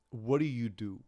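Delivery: normal talking voice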